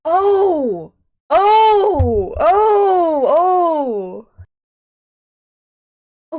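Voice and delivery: high, drawn-out wail; long loud howls of "oh". Real, not acted. Repeated wails on camera.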